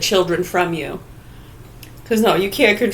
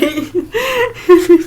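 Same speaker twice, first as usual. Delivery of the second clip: giggle burst; 11 semitones higher, 3 dB louder than usual. Pretend. Laugh demo.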